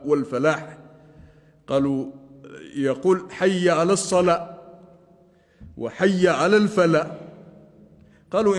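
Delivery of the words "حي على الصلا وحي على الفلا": "الصلاة" and "الفلاح" cut short, last consonant dropped; clipped final h. Shown as a demonstration. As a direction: end on "as-sala" and "al-fala", without the final h. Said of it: "وبتر آخر الصلاة".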